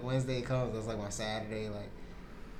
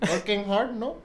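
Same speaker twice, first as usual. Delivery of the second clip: mock Indian boss accent, higher; teasing Indian accent impression, no?; Wally Balls Indian accent; 9 semitones higher, 6 dB louder than usual. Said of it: "That's what Wally Balls talk like".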